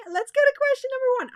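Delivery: fast, excited rush; racing through the line. Real, not acted. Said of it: "I feel like I'm, like, sl-, speeding up".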